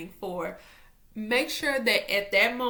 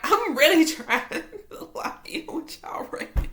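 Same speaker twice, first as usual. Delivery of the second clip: laughing; 6 semitones higher, 4 dB louder than usual. Real, not acted. Laughing while explaining scattered mind.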